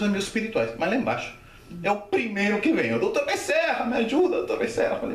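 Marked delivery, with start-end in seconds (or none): 2.9-4.9 high mock cry for help; desperate pleading. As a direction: act out begging to be rescued. Repeats desperate prayer while drowning. (súplica aguda, desespero encenado)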